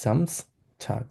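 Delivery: জামস্টাগ said slowly, starting with a buzzing z; z sound in Samstag. Shown as a demonstration. Teacher demonstrating Samstag to students, slow buzzing z. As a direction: stretch জামস্টাগ out, voiced z at the start. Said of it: "জয়ের মতো উচ্চারণ করবো আমরা জেটের মতো".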